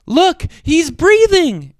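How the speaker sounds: high, loud relieved cry; excited cry. A narrator's reading. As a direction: burst out with joy. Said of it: "Ariel cried".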